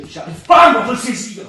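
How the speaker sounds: loud burst of frustration; agitated. Acted. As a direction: lose your grip for a moment. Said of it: "Je suis énervé".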